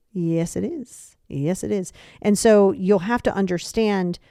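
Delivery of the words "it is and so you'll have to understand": usual speaking voice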